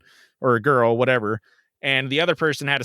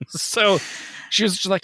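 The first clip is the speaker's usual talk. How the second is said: usual speaking voice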